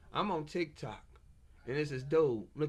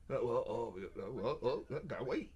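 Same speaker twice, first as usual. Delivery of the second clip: lower, softer hemming and hawing; mock lawyer dodging the question, lower and softer; mocking stalling lawyer; 7 semitones lower, 6 dB quieter than usual. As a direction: play a lawyer stalling, low and mumbly. Mocking the attorney's stalling answer.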